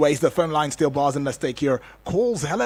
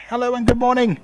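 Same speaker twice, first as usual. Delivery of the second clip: higher, louder hello to a faint caller; louder greeting; 9 semitones higher, 4 dB louder, faster than usual. Real, not acted. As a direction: call out so a distant caller hears. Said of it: "you sound so far away".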